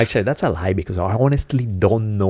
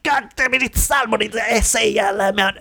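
very high-pitched frustrated rant; exasperated outburst; 13 semitones higher than usual. Retold or imitated. Speaker losing patience with listeners who misunderstand, exasperated. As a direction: blow up and spell it out in a high voice.

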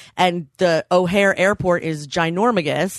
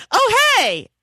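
high, bright mock oh hey; sudden recognition, friendly; surprised greeting; 4 dB louder than usual. Pat Flynn recognizes her in the airport, surprised. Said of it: "he goes".

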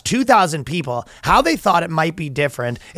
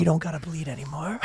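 very soft, secretive comfort; mock hushed reassurance; 12 dB quieter than usual. Comforting a stranger in a comic bit, hushed.

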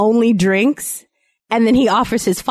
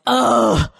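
mild mock yell; 7 semitones higher than usual. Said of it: "he just loudly yells".

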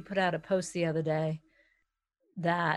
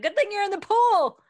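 mock quip; 13 semitones higher, 4 dB louder than usual. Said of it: "I'm like".

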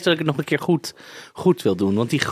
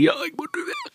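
panicked; 4 dB quieter than usual. Retold or imitated. Retold panic on entering ice bath.

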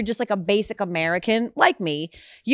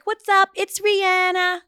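bright, much higher mock radio drop, slow on the name; 12 semitones higher, 3 dB louder, slower than usual. Host imitates the singer's cheerful, higher-pitched radio drop. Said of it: "And she's like".

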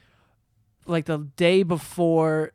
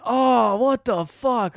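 disgusted; 5 semitones higher, much slower than usual. Retold or imitated. Quoting onlookers.

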